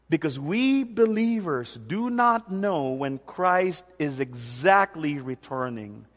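hushed and slow, pressing each word; soft, slow emphasis. Real, not acted. Pastor urging his listeners, quiet and slow. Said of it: "please listen as I say this now to you".

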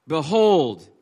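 loud, ringing Behold; proclaiming. Acted. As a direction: call the room to attention. Preacher reading scripture aloud.

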